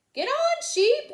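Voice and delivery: high, loud calling out; shouting. A narrator's reading. Everyone shouts to Sheep to climb aboard. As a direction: urge Sheep to jump on. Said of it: "everyone shouted".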